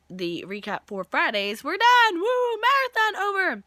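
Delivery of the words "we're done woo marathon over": high, loud cheer; triumphant squealed announcement; celebrating. Real, not acted. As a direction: whoop it up at the finish. Celebrates end of recap marathon.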